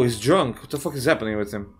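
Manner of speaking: ordinary talk, nothing marked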